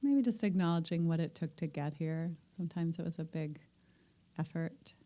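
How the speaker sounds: calm meditation teacher settling the room; guided meditation. Acted. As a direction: ease the group gently into stillness. Guides breathing and listening.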